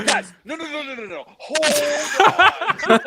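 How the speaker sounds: 学着他慌张地喊停，音调偏高; mocking a frantic "hold on", laughing through it; mock panicked stop. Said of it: "you literally went like this".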